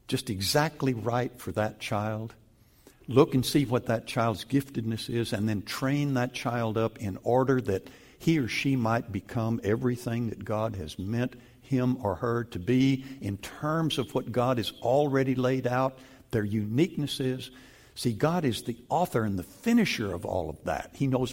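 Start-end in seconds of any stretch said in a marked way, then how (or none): none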